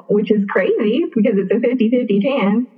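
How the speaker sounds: ordinary talk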